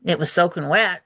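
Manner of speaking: ordinary delivery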